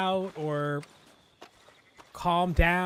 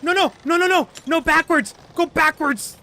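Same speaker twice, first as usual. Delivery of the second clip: panicked yelling; 12 semitones higher, 5 dB louder than usual. Real, not acted. Wagon rolling the wrong way.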